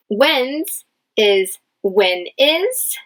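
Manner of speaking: usual speaking voice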